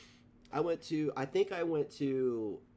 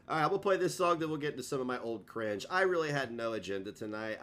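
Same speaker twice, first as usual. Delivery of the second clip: usual speaking voice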